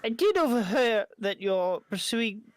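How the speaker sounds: game master attempting a female traveler; attempted female voice. Game master gives travelers directions, attempted female voice. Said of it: "my lack of ability to do a female voice".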